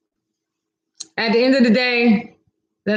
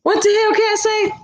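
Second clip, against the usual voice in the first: surprised outburst; 7 semitones higher, 4 dB louder, faster than usual. Real, not acted. Reacting to a shocking comment.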